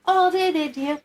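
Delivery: high-pitched, mock-soothing coo; patronising comfort. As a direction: imitate someone humouring a worrier. Imitates someone patronizingly soothing her.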